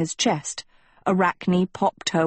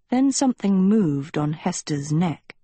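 usual speaking voice

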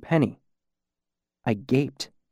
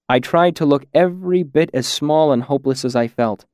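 usual speaking voice

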